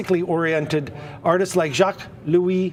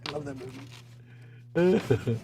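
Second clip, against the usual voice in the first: usual speaking voice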